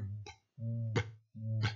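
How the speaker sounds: B repeated as separate short "b" bursts; bare letter sound. Shown as a demonstration. Teacher not afraid of looking ridiculous, each B a bare "b". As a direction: puff out each B as pure noise, no "é". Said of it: "c'est vraiment ça le bruit".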